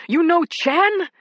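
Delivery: loud, slow, high shout. A narrator's reading. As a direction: yell it at her, threatening. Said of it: "he was shouting at me".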